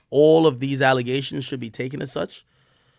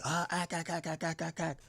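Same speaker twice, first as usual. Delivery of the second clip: mock chatter; 3 semitones higher, 16 dB quieter than usual. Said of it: "Everybody's like".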